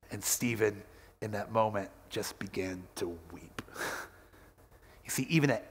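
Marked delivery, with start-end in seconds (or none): 0.1-3.6 low, fading to a near whisper; hushed storytelling. A narrator's reading. Preacher telling of the boy weeping, hushed fading voice. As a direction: let it drop away softly into breath.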